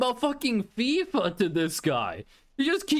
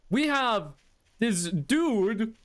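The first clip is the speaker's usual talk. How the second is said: ordinary delivery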